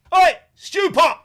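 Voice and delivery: long, high, loud yell across the room; heckling shout. Retold or imitated. Teen fans heckling an actor in the interval, loud yell. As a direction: bellow it like a drunk teenage heckler. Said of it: "so you can see Stewpot".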